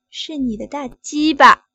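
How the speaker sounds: loud forced answer. Acted. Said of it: "大声点儿".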